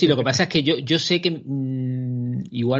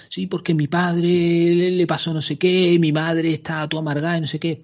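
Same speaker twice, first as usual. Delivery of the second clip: mock moaning friend; 5 semitones higher, 3 dB louder than usual. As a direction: drag out the complaint in a high whine. Imitating a friend complaining.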